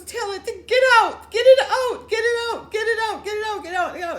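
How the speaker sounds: very high, very loud terrified shriek; frantic repeated cries to get it out; panicked screaming. Retold or imitated. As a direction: scream it like something is in the car. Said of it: "she's freaking out".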